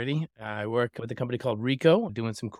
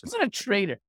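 teasing sibling denial, pitched way up; mock indignant protest; 9 semitones higher than usual. Real, not acted. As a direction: protest the accusation with a laugh in it. Brother protests being called traitor.

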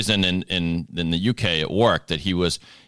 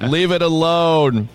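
slow, high, louder mock command; mock stern; 9 semitones higher, 5 dB louder, slower than usual. Real, not acted. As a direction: drag it out as a mock order. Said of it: "do the accent right".

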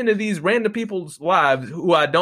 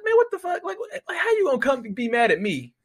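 exasperated disbelief; 9 semitones higher than usual. Retold or imitated. Retelling his outraged reply.

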